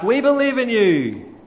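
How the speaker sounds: high, united crowd shout; crowd yelling back. A narrator's reading. Crowd shouting back to the tightrope walker, high and loud. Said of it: "the shout comes back".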